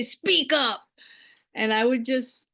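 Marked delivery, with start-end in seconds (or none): 0.0-0.8 retold teacher's command, higher in pitch; mock call to speak up; teacher's barked order. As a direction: imitate a teacher ordering a shy pupil. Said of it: "this tall, big man".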